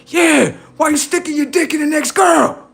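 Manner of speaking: high shouted mock cop. Cop yelling at the cheater, mock high shout. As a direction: bark it like a mocking cop. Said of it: "the cop in my shit like".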